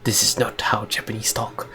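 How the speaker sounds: hushed, badly faked Japanese samurai accent; soft, measured samurai in a mock Japanese accent. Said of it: "improve your accent of the Japanese people".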